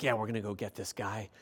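low, soft, quick mutter; muttered mock bravado. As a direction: mimic the guards muttering low and fast. Voices the arresting officers, aside.

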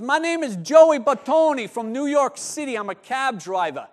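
loud, high-pitched New York cab driver; big practiced New York accent introduction; New York cabbie accent. Acted. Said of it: "Was that good? ... I practiced that a few times".

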